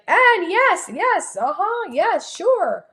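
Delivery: high, swooping, bubbly agreement; exaggerated gushing chatter; animated self-parody. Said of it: "I'm so used to being like".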